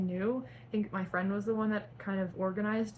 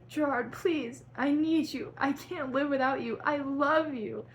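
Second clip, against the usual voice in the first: high, loud pleading yell; mock-tragic yell, slowing at the end; yelled plea; 7 semitones higher, 4 dB louder than usual. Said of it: "Frank yelled".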